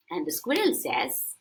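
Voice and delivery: plain reading voice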